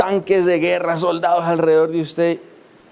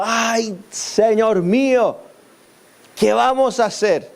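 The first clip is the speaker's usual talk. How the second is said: higher and slow, a mild mock panic; the servant wails in fright, acted lightly; frightened cry; 5 semitones higher, slower than usual. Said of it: "que gritó".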